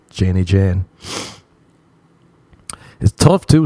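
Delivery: ordinary delivery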